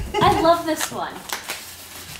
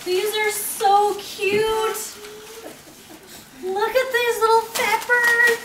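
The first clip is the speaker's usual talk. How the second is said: high, slow gushing; overwhelmed by cuteness; 6 semitones higher, 4 dB quieter, slower than usual. Real, not acted. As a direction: coo over it slowly. Gushing over gift.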